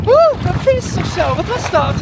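high, spooked cry at a fish; startled squeal. Real, not acted. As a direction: squeal in fright. Iets raakt haar in zee. (geschrokken gilletje)

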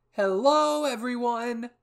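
very high, loud cheery greeting; calling out. Acted. Hanji greeting the squad, loud cheery call. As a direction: holler a big hello to the group. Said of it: "Hanji called out".

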